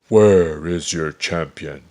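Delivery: loud booming roar; giant's roar. A narrator's reading. Goliath demanding a champion, loud roar. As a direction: bellow it as the giant. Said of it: "he roared".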